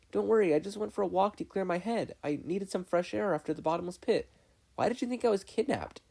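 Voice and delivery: put-on high boy's voice, calm; reassuring boy. Dipper calming his sister, high boy's voice. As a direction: soothe her like a kid brother.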